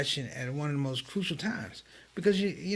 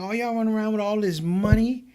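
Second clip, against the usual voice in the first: scolding elder; 7 semitones higher, 7 dB louder than usual. Retold or imitated. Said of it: "He said".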